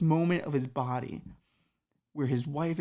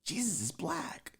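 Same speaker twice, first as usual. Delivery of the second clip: stunned newcomer; 7 semitones higher, 7 dB quieter, slower than usual. Acted. Newcomer stunned meeting Jesus.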